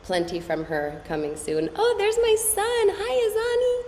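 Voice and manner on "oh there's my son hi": light up at seeing your son; delighted greeting. Real, not acted. Greets her son on camera.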